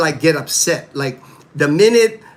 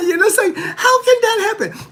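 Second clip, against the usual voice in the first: laughing disbelief; 3 dB louder than usual. Real, not acted. Laughing disbelief at empty Jollibee.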